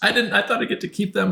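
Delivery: high-pitched mock whimpering; imitated crying. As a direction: imitate a sister's tearful protest. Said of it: "She was crying".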